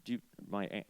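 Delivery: ordinary talk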